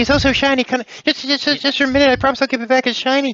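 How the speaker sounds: very high, loud squeaky pleading; shrill, stammering, shiny-obsessed squeal; frantic begging. Acted. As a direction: beg like an overexcited little creature. Other player jumps in as begging Chester.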